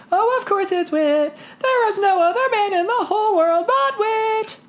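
put-on high, laughing girl voice in love; bubbling over with joy; giddy daughter. A narrator's reading.

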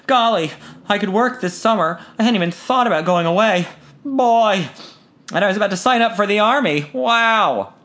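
bursting with delight; thrilled son. A narrator's reading. Son thrilled by college offer.

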